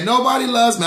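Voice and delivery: "Nobody" pitched high in a teasing whine; mock self-pity. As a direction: pretend sulk for the viewers. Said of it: "I'm just teasing".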